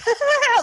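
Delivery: high, loud mock sob burst; exaggerated blubbering noise; imitated bawling. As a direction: burst out in a cartoonish bawl. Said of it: "I'm, like, bawling, like, like".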